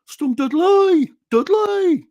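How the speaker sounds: high, loud, drawn-out Brummie; puppet-pig squeal in a West Midlands accent; exaggerated, stretched West Midlands accent. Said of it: "a West Midlands thing".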